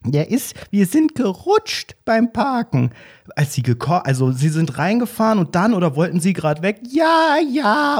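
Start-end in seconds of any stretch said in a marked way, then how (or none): 0.0-2.9 put-on high, slow old-lady voice; hammed-up granny excuse; old woman. 6.9-8.0 put-on very high, fluttering old-lady voice; shrill granny calling for Jürgen; hammed-up old woman calling her husband.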